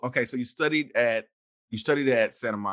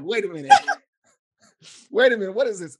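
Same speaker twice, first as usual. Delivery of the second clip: amused disbelief; 8 semitones higher, 6 dB louder, faster than usual. Retold or imitated. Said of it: "And I'm like".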